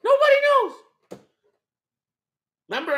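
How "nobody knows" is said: mock high echo. Mocking echo reply.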